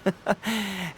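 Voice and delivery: short amused chuckle; a breezy, self-assured little laugh; light easy laugh. Acted. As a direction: laugh off the tension. Detective laughs it off.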